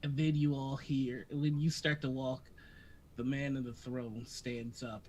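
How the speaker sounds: normal talking voice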